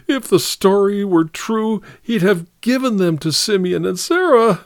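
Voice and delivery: high-pitched tearful lament; sobbing. A narrator's reading. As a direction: argue through sobs, catching for breath. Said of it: "sobbed Martha, wetting Ellery's undershirt".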